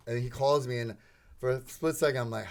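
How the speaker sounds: usual speaking voice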